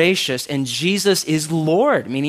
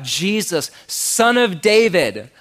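calling out; 5 semitones higher, 3 dB louder, slower than usual. Acted. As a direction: voice rising into a drawn-out, higher call. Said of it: "He called out".

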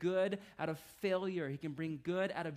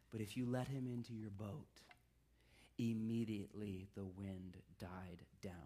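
hushed; 8 semitones lower, 6 dB quieter than usual. Acted. Reading scripture quietly, hushed.

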